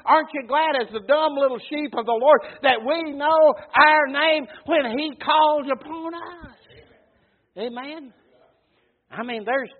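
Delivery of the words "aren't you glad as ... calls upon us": loud, slowing preacher's cry; full-force preaching. Acted. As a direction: drive the point home to the church. Preaching climax.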